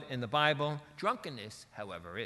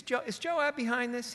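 high-pitched probing question as a king; David's suspicious question; 10 semitones higher than usual. Retold or imitated. King asks the widow if Joab is behind it, high and probing. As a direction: ask it high, as a king catching on.

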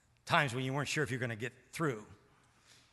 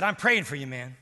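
Steve's fervent insistence; 11 dB louder than usual. Retold or imitated. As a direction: insist with full conviction. Steve praying loudly.

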